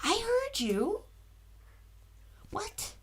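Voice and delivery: soft high dog-owner coo; pet talk to the dog. Real, not acted. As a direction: answer the barking puppy sweetly.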